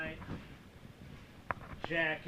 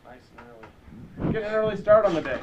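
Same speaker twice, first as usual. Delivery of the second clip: sarcastic; 14 dB louder, much faster than usual. Real, not acted. Sarcastic about the late hour.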